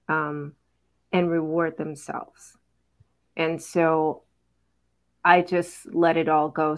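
usual speaking voice

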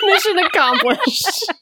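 slow, high laughing triumph. Real, not acted. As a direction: savor it with a laugh. Mock triumph after a joke.

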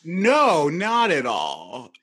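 loud high "No", then drawn-out "not at all"; emphatic agreement. Real, not acted. Host agreeing the film hasn't aged well, emphatic and drawn out. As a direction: stretch it out with total conviction.

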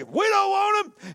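high, loud and slow squealing refusal; pigs refusing. Retold or imitated. Pigs refusing the demons, a loud slow high squeal. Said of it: "And the pigs say".

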